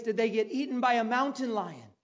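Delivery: usual speaking voice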